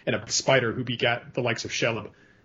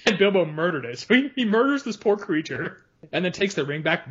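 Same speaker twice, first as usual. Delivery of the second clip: ordinary delivery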